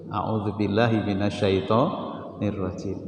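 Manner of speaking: murmured slowly as a prayer for refuge; slow, quiet recitation. Shown as a demonstration. Preacher showing the congregation how to seek refuge, slow quiet prayer. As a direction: recite it slowly and softly to calm yourself. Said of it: "Itu caranya begitu".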